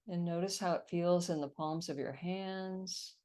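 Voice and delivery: calm, steady meditation guide; guided meditation. Acted. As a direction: lead them gently into relaxation. Leads a meditation on call.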